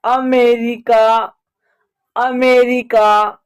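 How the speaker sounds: अमेरिका slowly, opening on short 'uh'; schwa in अमेरिका. Shown as a demonstration. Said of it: "ए का उच्चारण अ हो रहा है". (अमेरिका धीरे, छोटे अ से)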